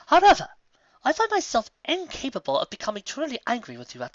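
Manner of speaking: high, loud, stately princess voice; regal letter voice. Acted. The princess writing to Trixie, stately and high. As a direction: read it like a dignified royal softening her rebuke.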